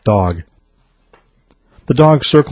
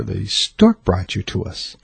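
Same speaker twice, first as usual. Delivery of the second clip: slow, soft, a hint of a prim embarrassed mother; lightly voiced mother dodging the question slowly; mother fibbing to her son, slow and soft; prudish mother; 5 dB quieter, slower than usual. A narrator's reading. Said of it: "said the slightly prudish mother".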